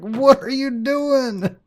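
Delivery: high, loud, drawn-out disbelief; mock outrage. Husband to wife who shot the tree, mock outrage. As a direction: stretch it out in exasperated mock horror.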